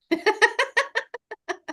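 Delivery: high burst of laughter; laughing. Real, not acted. Coach to client after suggesting a chat, high laugh. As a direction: laugh out in a high, tumbling run.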